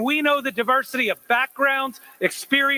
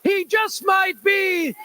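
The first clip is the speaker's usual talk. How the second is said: announcer introduction; 6 semitones higher than usual. Real, not acted. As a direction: hype the crowd up for the governor. Rally host introduces governor.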